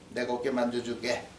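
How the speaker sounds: soft, low, soothing offer; tender husband. Acted. Preacher plays husband soothing his wife, tender. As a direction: drop low and gentle to comfort her.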